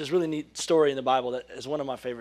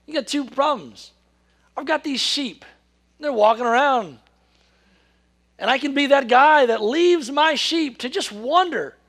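higher, louder voicing a shepherd torn; shepherd's dilemma; 11 semitones higher, 9 dB louder than usual. Preacher voicing a shepherd to the congregation, higher and louder. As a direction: play the shepherd weighing his sheep.

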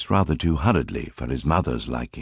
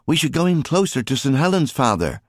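eager son; 9 semitones higher than usual. A narrator's reading. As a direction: urge your father on eagerly. Son Alfie voiced in story.

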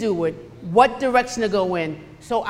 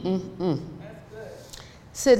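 usual speaking voice

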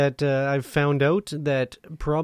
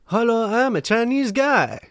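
high, loud mock Chinese accent; hammed-up Chinese accent; 7 semitones higher, 4 dB louder than usual. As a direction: put on a caricatured Chinese accent. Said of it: "he was, had that accent".

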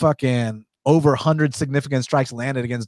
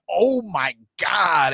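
awed; 3 semitones higher, much slower than usual. Retold or imitated. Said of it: "it was like a".

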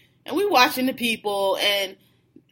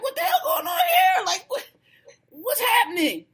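a far higher, half-shouted question; bewildered outcry; 12 semitones higher than usual. Retold or imitated. To himself as the strange girls join them, bewildered high outcry. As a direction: throw up your hands in total confusion. Total confusion at the scene.

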